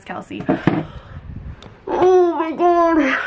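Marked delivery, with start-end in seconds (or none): 1.8-3.2 excited. Real, not acted. Reacting to engagement news.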